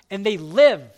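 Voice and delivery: loud on live; proclaiming. Acted. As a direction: land the word live with force. Climax of sermon point.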